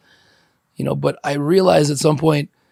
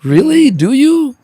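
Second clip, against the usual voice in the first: much higher, louder sarcastic disbelief; mock the idea with exaggerated disbelief; incredulous; 10 semitones higher, 6 dB louder, slower than usual. Speaker mocking his old urge to duel, high sarcastic disbelief.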